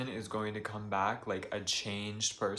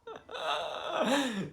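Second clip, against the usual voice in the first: a high, helpless laugh that sits close to crying; high teary laugh. Real, not acted. Vlogger laughing to keep from crying on camera. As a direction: let a laugh burst out instead of a sob. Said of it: "I have to laugh so I don't fucking cry".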